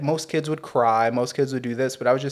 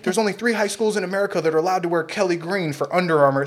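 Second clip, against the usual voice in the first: a hint of a drill-sergeant coach, higher; militant coach; 5 semitones higher than usual. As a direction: bark it lightly like a strict coach. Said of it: "he was very militant".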